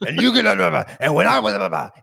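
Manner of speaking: loud, high-pitched blustering rant; hammed-up shouted tirade; mock berating. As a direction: imitate a boss haranguing trainees in gibberish. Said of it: "like, berating them almost".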